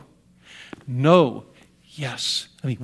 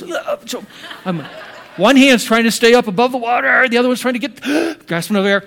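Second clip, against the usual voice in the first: laughing while telling of being choked, much louder and higher; half-laughing, loud, acting out the struggle for air; laughing through a retold near-drowning; laughing choke; 6 semitones higher, 6 dB louder than usual. Real, not acted. Choking in the whirlpool.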